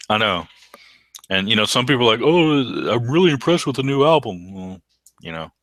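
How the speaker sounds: ordinary talk, nothing marked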